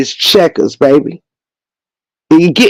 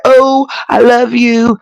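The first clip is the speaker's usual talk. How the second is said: high, slow mushy "I love you"; goofy lovey voice; 6 semitones higher, slower than usual. Retold or imitated. Mocking sappy players with a high, slow swoon. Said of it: "Goofy ass shit like".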